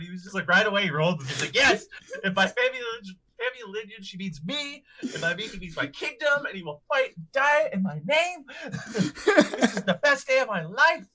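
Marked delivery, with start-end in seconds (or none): 1.6-11.1 overjoyed hero. Retold or imitated. Retelling Brian's overjoyed speech.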